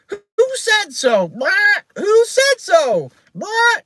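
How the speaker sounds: yelling parrot, very high; piercing, defiant bird screech; Penelope. A narrator's reading. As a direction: squawk a loud challenge like a parrot. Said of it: "Penelope yelled".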